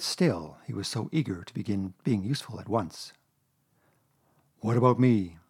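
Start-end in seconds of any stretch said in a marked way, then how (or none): 4.6-5.4 sad Pooh. A narrator's reading. Pooh feeling left out of the adventure, sad. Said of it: "said Pooh sadly".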